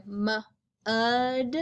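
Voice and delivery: mu-ud with the u drawn out slowly; stretched mud. Shown as a demonstration. Teacher to young readers, drawing out the u in mu-ud. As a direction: stretch the vowel in mu-ud, mu-u-ud. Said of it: "we hear mu-ud".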